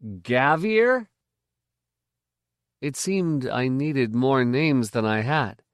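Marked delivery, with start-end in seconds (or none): 0.0-1.1 higher, rising pompous query; pompous, offended. A narrator's reading. Boy questions the stranger's name, pompous and offended. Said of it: "asked rather pompously, as if offended".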